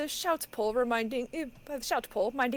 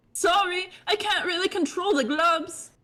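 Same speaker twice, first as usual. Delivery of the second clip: louder high bubbly apology; high fish girl voice; 5 semitones higher, 6 dB louder than usual. Acted. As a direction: squeak it out bashfully. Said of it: "this voice more distinct".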